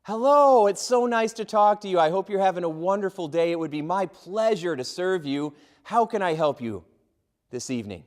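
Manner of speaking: phone agent overjoyed to hear the caller, gushing; gushing friendly agent. Retold or imitated. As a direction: greet them like a long-lost best friend. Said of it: "like I'm their lost best friend".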